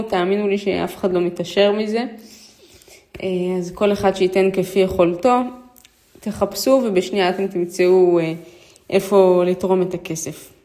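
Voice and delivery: normal talking voice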